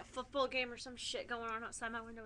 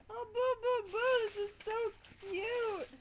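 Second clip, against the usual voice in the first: higher, slow cooing over something cute; 10 semitones higher, 4 dB louder than usual. Real, not acted.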